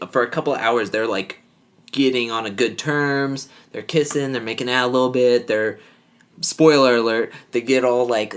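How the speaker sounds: normal talking voice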